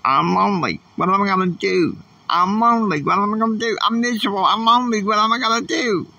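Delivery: high, pleading lament that keeps climbing; high whining prayer of loneliness; whiny plea. Retold or imitated. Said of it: "I was crying out to Heavenly Father".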